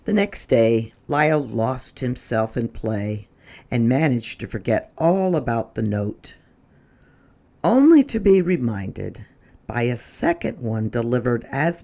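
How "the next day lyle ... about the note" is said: ordinary delivery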